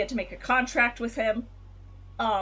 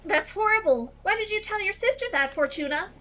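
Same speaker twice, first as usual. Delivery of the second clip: higher, dismayed friend voice; shocked game character; 7 semitones higher than usual. Acted. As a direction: read it as an upset friend.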